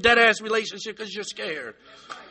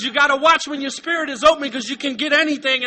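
usual speaking voice

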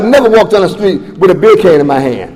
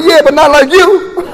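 higher, laughing through the answer; 7 semitones higher than usual. Real, not acted. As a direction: brush it off with a laugh. Laughs off question about getting high.

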